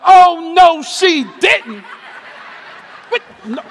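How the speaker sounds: loud, high mock outrage; hammed-up sister taking offense; wife Pam, loud mock indignation; sassy wife. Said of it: "Pam would have been like".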